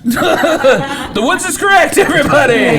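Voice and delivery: slow, high, drawn-out host announcement; game show host. Acted. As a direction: announce the answer like a quiz host. Game host announces right answer.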